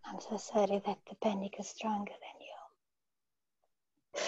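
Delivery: slow, soft whispered repeat. Real, not acted. Client repeating the healer's words in a slow whisper. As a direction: breathe the words out slowly.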